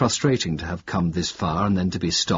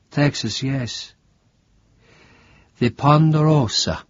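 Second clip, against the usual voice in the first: higher, wary voice of the sick priest; a frail priest, suddenly alert; startled priest asking a stranger; confused old priest; 4 semitones higher, slower than usual. Acted. Priest answers dreamily.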